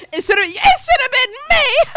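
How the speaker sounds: high, drawn-out fake heartbroken cry; mock wailing. Streamer jokes it should've been them, mock wailing. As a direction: wail it melodramatically through laughter. Jokes about [name]'s pregnancy.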